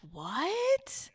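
high, rising retold gasp of shock; stunned disbelief. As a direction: reenact being floored, voice sliding right up. Reacts to he lives in Miami.

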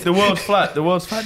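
high, sing-song parroting of his claim; mocking flat-earther. Mimicking the mate at work, mock singsong. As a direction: parrot him like a know-it-all.

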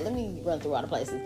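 usual speaking voice

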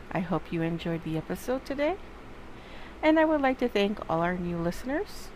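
soft, even outro read; podcast outro. Acted. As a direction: wrap up the show calmly.

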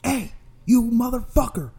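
high, loud angry holler; retold yell. Delivery man yells at the attacker, high angry shout. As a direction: yell out to grab his attention. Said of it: "So I yell".

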